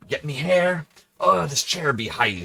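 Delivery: loud, high hammed-up pirate; piratey. Streamer hamming it up for the piratey hat, piratey. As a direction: act out a put-on pirate. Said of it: "what does that accent sound like".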